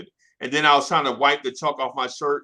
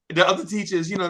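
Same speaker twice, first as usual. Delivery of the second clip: ordinary delivery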